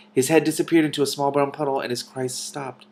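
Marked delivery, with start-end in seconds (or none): none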